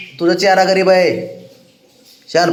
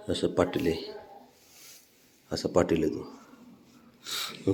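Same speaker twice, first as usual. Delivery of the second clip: slow whisper of praise; fond whisper; 9 semitones lower, 16 dB quieter, much slower than usual. Real, not acted. (बाळाला कौतुकाने कुजबुजणे)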